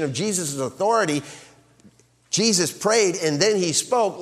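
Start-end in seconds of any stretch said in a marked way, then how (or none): none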